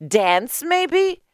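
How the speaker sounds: high, mocking questions; high-pitched sceptical neighbour; incredulous woman. A narrator's reading. Leah answers in high character voice.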